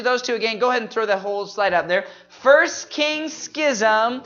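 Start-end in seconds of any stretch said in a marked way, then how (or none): 2.3-4.2 slow, higher, drawn-out names; group recitation. Shown as a demonstration. Teacher leading the congregation in the next books, slow group recitation. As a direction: stretch each book and key word. Leads the memory list.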